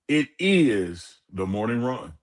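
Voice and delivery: slow, drawn-out announcer call; show intro announce. Acted. Host announcing the show name, drawn-out announcer call. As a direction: announce the show like a radio tag.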